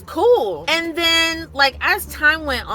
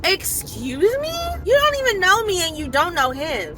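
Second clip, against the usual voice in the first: taken aback and higher-pitched; offended indignation; 8 semitones higher than usual. Retold or imitated. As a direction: protest in rising, indignant disbelief. Offended by stranger's remark.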